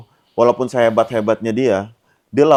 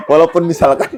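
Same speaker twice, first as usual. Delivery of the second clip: laughing; 6 semitones higher, faster than usual. Real, not acted. Host laughs while posing example.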